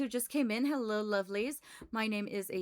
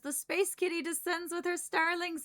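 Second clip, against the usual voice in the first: higher, grand announcer voice; dramatic announcer; 5 semitones higher than usual. Acted. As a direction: announce it like an epic intro. Announcing the incoming raid.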